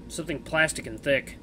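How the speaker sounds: normal talking voice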